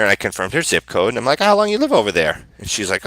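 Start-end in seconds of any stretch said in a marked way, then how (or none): 1.4-2.4 bright friendly. Retold or imitated. Friendly question to testy client.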